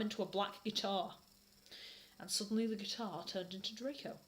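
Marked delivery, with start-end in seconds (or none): none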